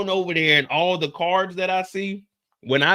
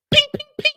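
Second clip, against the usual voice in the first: mock ricochet; 6 dB louder, faster than usual. Imitating gunshots.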